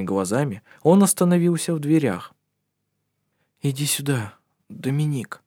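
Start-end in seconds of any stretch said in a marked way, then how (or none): 3.6-5.4 fervent whisper. A narrator's reading. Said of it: "горячо прошептал он".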